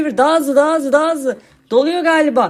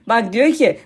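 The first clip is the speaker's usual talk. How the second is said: ordinary talk, nothing marked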